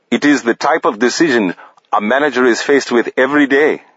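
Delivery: a hint of the manager's even, reassuring lilt; Bashir's accent. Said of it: "even in tone, reassuring".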